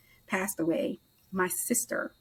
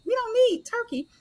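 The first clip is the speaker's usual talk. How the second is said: exasperated; 7 semitones higher, faster than usual. Retold or imitated. Arguing with husband about turkey.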